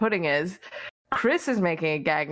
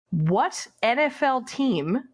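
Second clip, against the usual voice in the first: slow quiz question; slower than usual. Acted. Reading out a trivia question.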